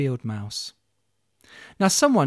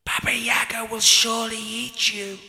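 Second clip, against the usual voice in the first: creepy house voice; 7 semitones higher than usual. Acted. Talking chicken-legged house warning Olga away, eerie. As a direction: warn her off like a spooky house. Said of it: "said the house".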